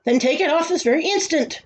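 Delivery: high-pitched royal order; commanding. A narrator's reading. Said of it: "commanded the king more loudly than before".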